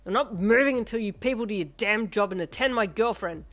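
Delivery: loud furious refusal; angry shout. Acted. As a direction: shout back stubbornly. Shouting at the nurse.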